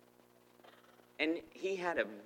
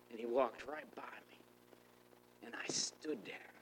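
soft, whispery storytelling; hushed awe; 9 dB quieter than usual. Real, not acted. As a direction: tell it softly, still stunned. Awed retelling, frozen as he passes.

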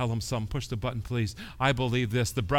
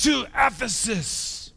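booming divine proclamation; God calling out; 9 semitones higher, slower than usual. Retold or imitated. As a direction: call it out like a divine summons. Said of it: "all of a sudden he says".

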